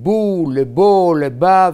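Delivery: בו, לבו, לבה as boo, le-boh, le-bah, vowels drawn out; contrasting vowels. Shown as a demonstration. Teacher showing speech tells syllables apart, contrasting the vowels. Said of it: "אתה מבדיל בין".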